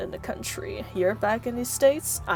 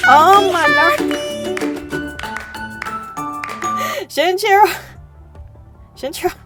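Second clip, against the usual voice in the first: high loud shriek; excited shriek; 10 semitones higher, 9 dB louder than usual. Real, not acted. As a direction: scream the name with delight. Shrieking at donations.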